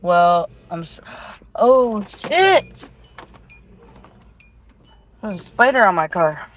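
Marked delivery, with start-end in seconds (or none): 1.5-2.9 startled yelp. Real, not acted. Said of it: "Why you just react like that?".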